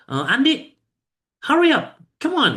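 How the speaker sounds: high, loud scolding hurry up; urgent mock-angry call; a bit angry. Shown as a demonstration. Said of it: "intonasi agak marah gitu ya".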